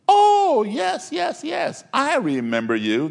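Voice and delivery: high, sudden mock surprise then back to calm; imitating a startled man placing him; surprised recognition.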